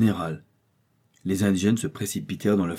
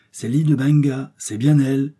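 louder and higher, sure of himself; excited recognition; 5 semitones higher, 6 dB louder than usual. Acted. Doctor telling his friends he found the island, triumphant. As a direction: announce the find with triumph.

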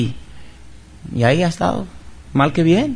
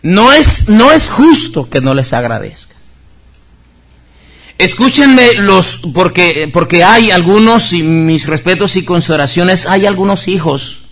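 higher, louder preaching voice; fervent preaching; 4 semitones higher, 6 dB louder than usual. Real, not acted. Pastor urging the congregation to give thanks, fervent loud preaching.

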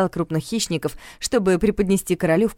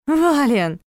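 higher reproachful call of his name; 8 semitones higher, 3 dB louder than usual. A narrator's reading. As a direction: chide him. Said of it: "с укором заметила я".

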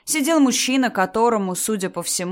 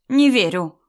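loud, higher flat refusal; sudden shout; 4 semitones higher, 7 dB louder, slower than usual. A narrator's reading. Mother-in-law rejecting wife's explanation, sudden shout. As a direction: burst out loudly in disbelief. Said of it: "вдруг закричала Раиса Ивановна".

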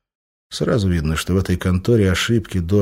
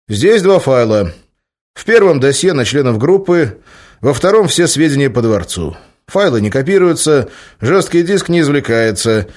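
higher, louder crisp report; businesslike report; 6 semitones higher, 6 dB louder than usual. A narrator's reading. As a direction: report it like a soldier. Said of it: "деловито доложил он".

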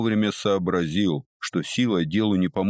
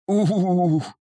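high, long "ooh" of pain; drawn-out groan of pain; 12 semitones higher, 4 dB louder than usual. A narrator's reading. Businessman struck by toothache, high drawn-out groan. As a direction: moan out a pained, high-pitched ooh. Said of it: "протянул он".

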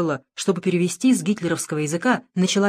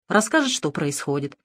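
usual speaking voice

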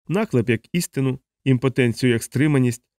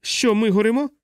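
high frantic questions; panicked wailing; 10 semitones higher than usual. A narrator's reading. One-legged patient on crutch wails in panic about fire. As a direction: cry out in panic, much higher. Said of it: "панічно волаючи".

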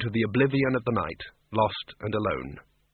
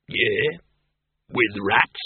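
interested bogeyman; much slower than usual. A narrator's reading. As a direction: ask like a hopeful bogeyman.

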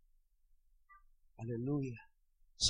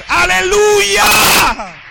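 long, loud, higher stretched aleluya; drawn-out hallelujah; 11 semitones higher, 14 dB louder, much slower than usual. Acted. Preacher to church, slow loud stretched hallelujah. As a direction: hold each aleluya like a rallying cry.

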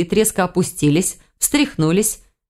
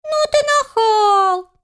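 very high, long drawn-out squeak; drawling; much slower than usual. A narrator's reading. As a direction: stretch it out in a tiny teasing voice. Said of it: "протянул его собрат".

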